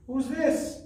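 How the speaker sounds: squeaky high guessing-game question; high quiz voice. Shown as a demonstration. Teacher quizzing children in a high playful voice. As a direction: ask the kids like a playful puppet.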